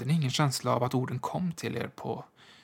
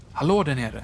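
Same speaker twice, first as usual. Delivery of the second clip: calling out; 2 semitones higher, 4 dB louder than usual. A narrator's reading. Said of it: "hörde jag hur någon ropade".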